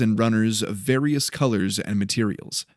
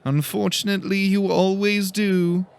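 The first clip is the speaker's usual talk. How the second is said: smirking reply; 9 semitones higher than usual. A narrator's reading. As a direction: tease him with a smirk you can hear. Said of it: "he replied with a slight smirk".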